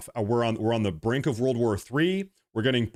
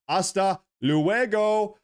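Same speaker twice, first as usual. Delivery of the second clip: mock farewell; 8 semitones higher, much slower than usual. Mocking banished chatter.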